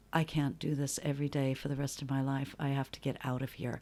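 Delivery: quiet resigned vow to self; low despairing mutter. Retold or imitated.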